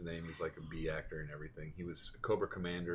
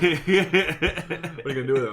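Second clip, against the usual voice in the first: laughing; 9 semitones higher, 15 dB louder, slower than usual. Retold or imitated. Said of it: "And I'm like".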